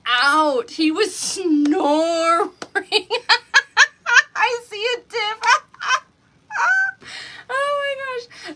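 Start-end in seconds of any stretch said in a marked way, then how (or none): 2.6-4.3 loud, high real laugh breaking out; high burst of real laughter. Real, not acted. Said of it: "I'm just cracking up". 4.3-8.5 talking high through real laughter; words squeezed out between laughs; laughing. Real, not acted. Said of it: "I'm just cracking up".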